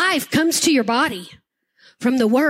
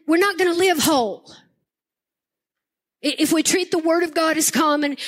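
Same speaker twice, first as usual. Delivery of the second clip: ordinary talk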